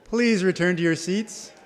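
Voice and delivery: ordinary talk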